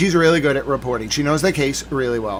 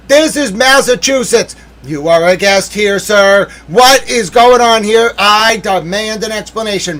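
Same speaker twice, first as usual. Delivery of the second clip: raised, theatrical courtroom outrage; mock indignation; 6 semitones higher, 7 dB louder, slower than usual. Addressing the guest lawyer about missing cameras, mock indignant. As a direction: demand answers with hammed-up indignation.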